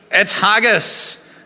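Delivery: teasing slow reveal of haggis; drawn-out reveal. Acted. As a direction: savour the word like a big reveal. Reveal to the congregation.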